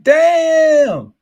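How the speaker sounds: loud, very high exclamation; acted-out shocked cry; mock dismayed outburst. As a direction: belt it out in exaggerated dismay. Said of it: "you would be like".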